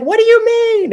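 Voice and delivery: very high, loud bewildered question; uncle baffled. Retold or imitated. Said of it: "he's like".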